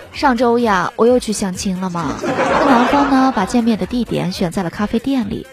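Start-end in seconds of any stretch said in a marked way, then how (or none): none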